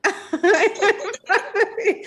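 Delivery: bursts of real laughter; high laughing burst. Real, not acted. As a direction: laugh it out before the words. Laughing at own story.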